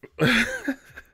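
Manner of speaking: bursts out laughing high and loud; a sudden shrieking laugh. Real, not acted. Laughing at chat comment.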